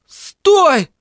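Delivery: высокий резкий крик; останови друга криком; заорал. Acted. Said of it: "заорал я". (Горди кричит Тедди)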